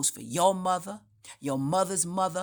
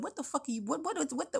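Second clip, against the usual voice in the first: high, soft, stammering disbelief; stunned stammer; 9 semitones higher, 8 dB quieter, much faster than usual. Retold or imitated. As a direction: trip over the words in shock. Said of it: "I'm just gonna tell you how I said it to him".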